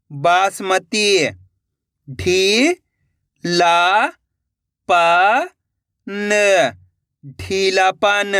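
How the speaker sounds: "ढी… ला… प… न" each held alone, then "ढीलापन" joined; slow, drawn-out reading drill on "ढीलापन"; syllable-by-syllable reading. Shown as a demonstration. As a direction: sound out "ढीलापन" letter by letter with long pauses. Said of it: "जैसे कि मैं पढ़ रहा हूँ".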